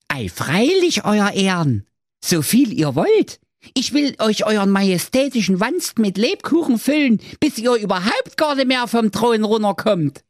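very high fawning character voice; Hans voice. Acted. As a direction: flatter him in a high cartoon voice. Hans answers the king.

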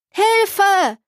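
high, distant call for help; a child's desperate cry from afar. A narrator's reading. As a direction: yell it from far out on the water. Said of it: "erklang es noch einmal".

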